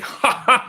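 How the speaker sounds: a boastful student laughing at his rival; big mocking laugh. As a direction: throw the head back and guffaw. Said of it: "throws his head back and does a big laugh".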